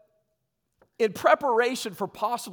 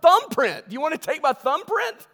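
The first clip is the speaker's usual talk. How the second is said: indignant retort; 8 semitones higher, 8 dB louder than usual. Retold or imitated. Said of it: "I'm like".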